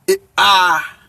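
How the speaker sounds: ah held long and open; brief eh, then a broad, long ah; preferred pronunciation. Shown as a demonstration. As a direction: stretch ah as "aaah". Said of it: "they pronounce it".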